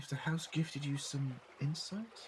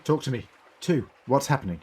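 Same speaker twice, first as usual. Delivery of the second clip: louder, firm urgent call; 10 dB louder than usual. Acted. Doctor urging Two to tell what is happening. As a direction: push him to answer, urgently.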